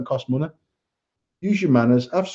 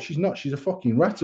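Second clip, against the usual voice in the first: muttered retort; 7 dB quieter than usual. Retold or imitated. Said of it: "And I'm like".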